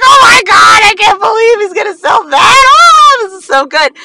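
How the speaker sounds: very high, loud excited shrieks; thrilled crowd. Retold or imitated. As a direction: squeal like a delighted crowd. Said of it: "the crowd was like".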